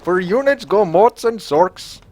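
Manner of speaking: high, lilting Indian accent. Shown as a demonstration. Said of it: "do this in an Indian accent".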